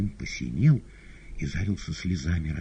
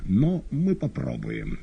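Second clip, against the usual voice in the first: Woland voice; 4 semitones higher, slower than usual. Acted. Воланд, медленно, иным голосом.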